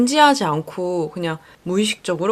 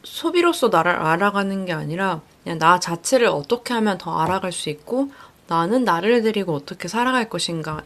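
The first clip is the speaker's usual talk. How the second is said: ordinary talk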